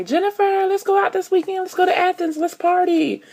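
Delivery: high excited party-friend voice; mock the friends begging to go out, way up high; imitating partying friends. Friends calling to go party in Athens, high mock. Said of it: "friends calling me".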